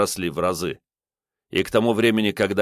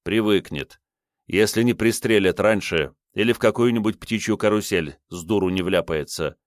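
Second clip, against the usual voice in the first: usual speaking voice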